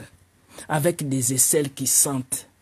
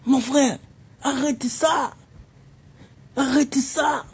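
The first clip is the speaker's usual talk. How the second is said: mock pleading; 10 semitones higher, slower than usual. Comedian pleading, laughing.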